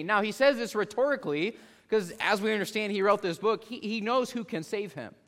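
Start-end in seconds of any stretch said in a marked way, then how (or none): none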